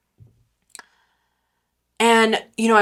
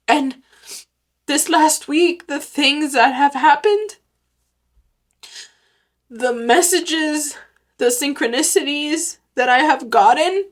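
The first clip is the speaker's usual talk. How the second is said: fighting back real tears; crying; 3 semitones higher than usual. Real, not acted.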